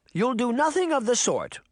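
high, firm grandmotherly voice; Granny Relda. A narrator's reading. Grandmother forbidding the giant, firm. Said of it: "Granny Relda replied".

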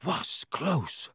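soft, panting relief; out of breath. Acted. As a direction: gasp it out breathlessly. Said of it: "panted Ron".

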